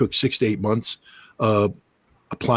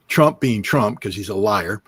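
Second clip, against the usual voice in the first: ordinary delivery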